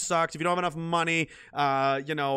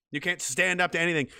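normal talking voice

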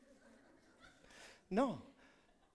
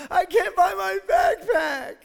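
put-on high, loud, slow whiny child voice; imitating a little boy about to cry; 9 semitones higher, 9 dB louder, slower than usual. Pastor imitating his son wailing about the backpack.

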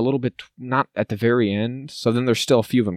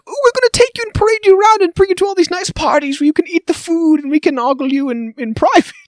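much higher, sugary falsetto; mocking Capitol host; 3 dB louder than usual. Mocking how the Capitol lures tributes to parties, in a high coo. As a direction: coo like a fake gracious host.

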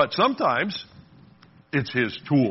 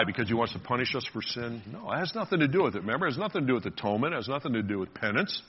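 ordinary talk, nothing marked

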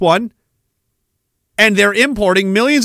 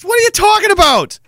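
exasperated friend; 11 semitones higher than usual. Retold or imitated. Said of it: "So you'll be like".

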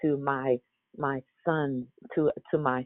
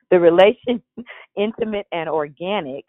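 relation said with a real laugh mid-phrase, louder; laughing retake; 5 semitones higher, 5 dB louder than usual. Real, not acted. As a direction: redo the line laughing at the slip. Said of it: "or excuse me".

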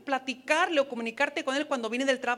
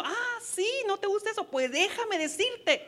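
higher, sarcastic mock comeback; mock flare-up; 6 semitones higher than usual. Wife imagining snapping at her husband, mock sarcastic. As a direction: play the wife taking offense, lightly. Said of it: "yo también me hubiera encendido".

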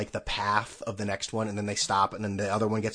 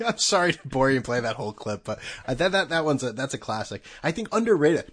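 ordinary talk, nothing marked

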